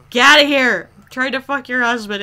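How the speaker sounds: loud, high shooing shout; mock outrage. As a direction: yell it as a playful dismissal. Acted outburst at game character.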